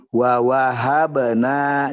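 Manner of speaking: slow and deliberate; slowly. Shown as a demonstration. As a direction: ease into it gently. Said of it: "pelan-pelan aja".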